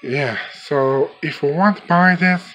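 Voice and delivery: usual speaking voice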